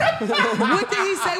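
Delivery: high and slow through laughter; cracking up while asking; laughing, high voice. Real, not acted. Cracking up at friend's reply.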